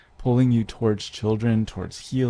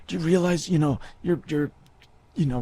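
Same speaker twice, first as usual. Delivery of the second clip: angry priest; 8 semitones higher than usual. Retold or imitated. Priest scolding the boy caught with holy water, high angry rebuke. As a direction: tear into the kid. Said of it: "He's like".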